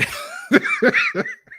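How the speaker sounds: high-pitched wordless laugh. Real, not acted. Laughs at own running joke.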